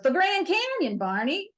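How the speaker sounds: lightly voiced Fred, deadpan reveal; lightly voiced Fred answering Barney; Fred impression. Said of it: "he looks at it and goes".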